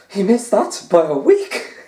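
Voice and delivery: high, loud, slow hammed-up commentator exclamation; Phil Yates impression. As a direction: exaggerate a pundit's mock outrage at a miss. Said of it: "you gotta do Phil Yates".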